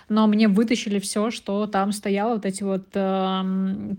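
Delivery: ordinary delivery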